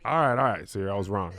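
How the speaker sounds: normal talking voice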